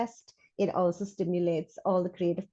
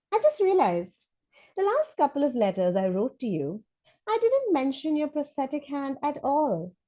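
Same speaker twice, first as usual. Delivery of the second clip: put-on child voice; 4 semitones higher than usual. Reads child's letter in character.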